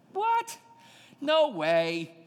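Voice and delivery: a stunned squeak of what; shocked what. Real, not acted. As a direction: explode in disbelief. Shocked reaction to the prophecy.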